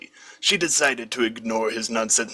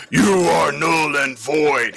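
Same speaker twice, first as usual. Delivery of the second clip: slow, louder shout of triumph; delighted shout; 4 semitones higher, 3 dB louder, slower than usual. A narrator's reading. Villain shouts in delight at the finish, slow and loud. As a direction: boom it out gleefully. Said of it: "he shouted with delight".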